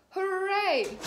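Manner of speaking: loud high cheer; big happy hooray. A narrator's reading. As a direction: cheer like a delighted little pig. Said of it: "cheers Peppa".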